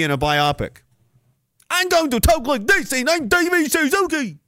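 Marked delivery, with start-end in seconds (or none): 1.7-4.4 put-on silly accent for David Suzuki, much higher; mocking the casting with a high put-on David Suzuki accent. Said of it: "I'm gonna make him one accent".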